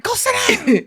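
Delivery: put-on high-pitched scolding of a child; mock scolding. Irritable mom to her child in her face, high mock scolding. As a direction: shoo them away in a high voice.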